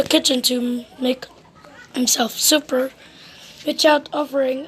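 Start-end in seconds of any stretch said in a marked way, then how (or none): none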